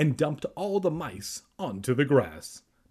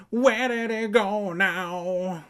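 high, loud, drawn-out holler; calling out; 8 semitones higher, 5 dB louder, much slower than usual. A narrator's reading. Evan calls after Kermit running indoors, high stretched-out calling. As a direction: holler it slowly at a much higher pitch. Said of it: "Evan called".

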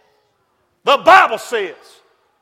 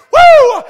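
high, loud church whoop; joyful whoop; 12 semitones higher, 8 dB louder than usual. Acted. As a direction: let out a joyful cry of praise. Preacher whoops mid-quote.

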